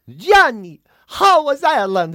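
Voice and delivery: loud, high, slow cry of a doting foreign ma; foreign student's mother. Acted. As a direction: call out to your son as an Italian mother. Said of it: "going home to their ma and their mother".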